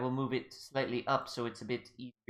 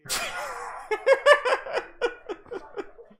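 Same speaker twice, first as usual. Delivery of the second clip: loud high cackling laugh; 7 dB louder than usual. Real, not acted. GM bursts out laughing too loudly at own whisper. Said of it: "that laughter was a little too loud".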